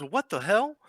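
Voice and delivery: high, shocked voice; shocked cry. Acted. Callahan coming back to find the body, high and startled. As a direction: react like you just saw a body. NPC returns, sees body.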